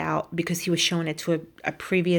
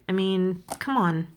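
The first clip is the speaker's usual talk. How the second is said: ordinary talk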